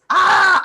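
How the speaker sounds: hammed-up exasperated yell; exasperated cry. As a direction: cry out as if it hurts your ears. Mock outrage at a mispronunciation.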